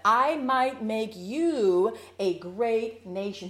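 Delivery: slow, deliberate stress on every word; emphasized line. Shown as a demonstration. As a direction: lean on I and let each word land. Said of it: "Double underline that".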